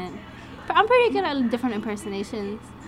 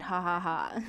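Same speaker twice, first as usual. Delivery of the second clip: mock laugh; 3 semitones lower, 7 dB quieter, slower than usual. Shown as a demonstration. Demonstrates laughing at a joke.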